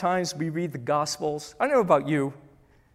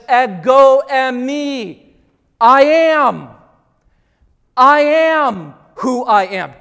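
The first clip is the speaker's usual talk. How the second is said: slow, loud, weighty declaration, voice raised; booming divine proclamation; 5 semitones higher, 10 dB louder, much slower than usual. Acted. The Lord answering Moses from the burning bush, booming proclamation. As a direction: proclaim each phrase at full force, slowly.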